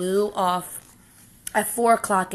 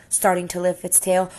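ordinary delivery

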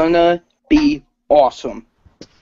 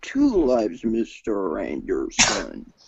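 Agent Smith; 2 semitones lower, slower than usual. Acted. Agent accusing Mister Anderson of two lives, slow villain voice. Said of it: "impression of Agent Smith from The Matrix".

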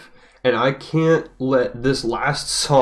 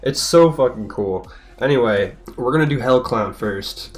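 ordinary delivery

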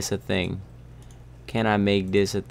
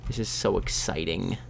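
soft, low-key excitement; understated excitement; 3 semitones lower, 7 dB quieter than usual. Pretend. Player about to enchant a sword, understated. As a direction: say it softly, holding back. Understated first take, then yelled.

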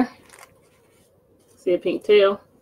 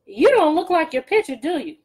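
Gritch's voice; 5 semitones higher, 4 dB louder than usual. Acted. Gritch confronting the farmer, high and loud. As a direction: screech it like a hungry witch. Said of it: "she said".

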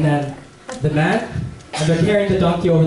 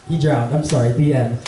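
ordinary talk

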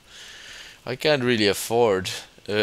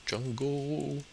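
high, soft, stretched-out name; playful drawn-out echo; 5 semitones higher, 9 dB quieter, much slower than usual. Pretend. Friend echoing the yelled Django, playful high drawl.

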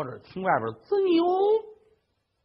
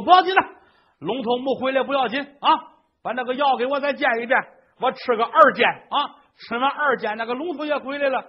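much higher, confident; high, self-satisfied old man; smug old man; 7 semitones higher, 5 dB louder than usual. Acted. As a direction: reassure her foolishly. Old man confidently plans second dose.

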